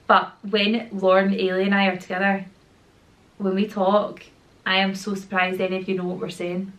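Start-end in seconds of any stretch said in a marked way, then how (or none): none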